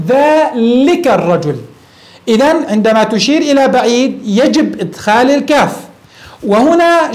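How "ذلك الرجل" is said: ذلك الرجل drawn out very slowly so the lam is heard; careful teacher demo; slow example phrase. Shown as a demonstration. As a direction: stretch ذلك الرجل for the class. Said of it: "ويجوز أن تقرنها باللام عند العرب فتقول".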